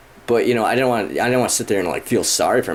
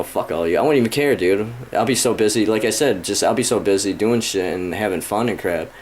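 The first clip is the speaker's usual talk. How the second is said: usual speaking voice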